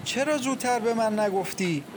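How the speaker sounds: repeated lament climbing in pitch; wailing. A narrator's reading.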